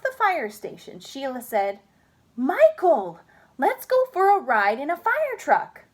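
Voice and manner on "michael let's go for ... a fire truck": eager. Acted. Sheila urging Michael.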